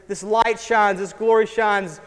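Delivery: ordinary delivery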